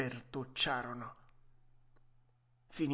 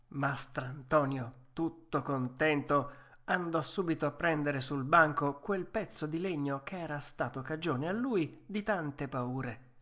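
usual speaking voice

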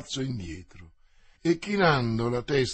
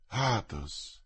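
anguished; 5 semitones lower, 6 dB quieter than usual. Acted. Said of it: "torcendosi le mani".

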